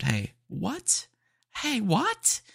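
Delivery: high-pitched mock British exclamation; mock British accent. Teasing a very British customer, hammed-up British accent. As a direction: squeak it out in a fake British accent. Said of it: "his accent sounded like an American doing a British accent".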